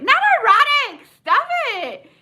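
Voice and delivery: high, loud outraged squeal, half laughing; laughing protest. Real, not acted. Host correcting co-host's "erotic" joke, loud laughing squeal. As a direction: shriek it in giggly denial.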